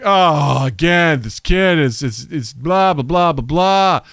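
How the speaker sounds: dismissive parents. Retold or imitated. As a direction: brush the kid off like tired parents. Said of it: "And they're like".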